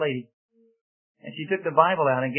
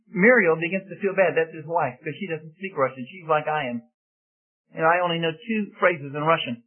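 ordinary talk

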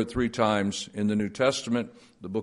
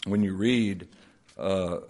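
ordinary talk

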